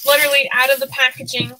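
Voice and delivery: ordinary talk, nothing marked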